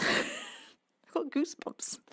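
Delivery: breathy giggle, half whispered; laughing whisper. Real, not acted. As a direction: laugh it out softly, almost whispering. Giggling at own goosebumps.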